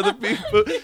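high, giggly beep boop; laughing beep boop. Pretend. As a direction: laugh through the robot sounds. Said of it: "I do".

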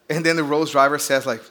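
normal talking voice

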